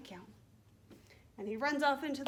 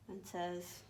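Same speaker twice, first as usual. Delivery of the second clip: usual speaking voice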